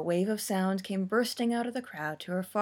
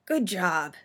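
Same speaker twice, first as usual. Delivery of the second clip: croaked; 5 dB louder than usual. A narrator's reading. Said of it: "she croaked".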